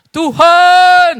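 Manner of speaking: panggilan keras dengan suara sendiri; seruan biasa. Acted. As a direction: seru nama Tuhan dengan suara sendiri, lebih keras. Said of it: "Oh, suara wanita, ganti dulu". (sebelum ganti ke suara wanita, seruan keras suara sendiri)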